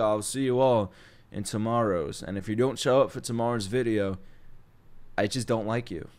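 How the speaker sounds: ordinary talk, nothing marked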